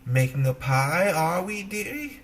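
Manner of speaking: put-on a sly old beggar woman coaxing the girl; old witch voice. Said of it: "heard the old beggar woman speak".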